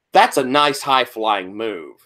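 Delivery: normal talking voice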